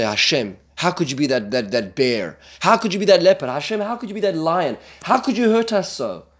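hammed-up wail of a complainer; mock anguished complaint. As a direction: cry out in mock despair, rising. Quoted complaint within God's speech.

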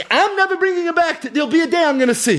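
high, pressing voice of David; emphatic David. Acted. David explains why he stopped fasting.

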